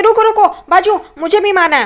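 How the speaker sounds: high, pushy acted call; urgent interruption. Roshni pushes in to join the beating, acted eagerness.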